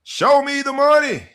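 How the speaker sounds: high, loud quoted catchphrase; movie quote shout. Retold or imitated. Host quoting a movie line to open the show, loud and high. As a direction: belt the famous line like the film. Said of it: "pop culture standpoint".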